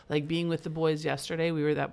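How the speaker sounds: ordinary talk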